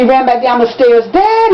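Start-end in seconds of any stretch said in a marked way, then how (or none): 1.1-1.5 high, piercing scream for his father. A narrator's reading. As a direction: scream into the empty house. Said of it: "he screamed as he made his way".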